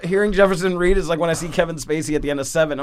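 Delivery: ordinary talk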